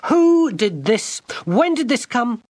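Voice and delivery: high, slow raging outburst; raging girl demanding who opened her letter. Acted. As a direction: spit out the questions in a rage. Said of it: "Gwendolen was raging again".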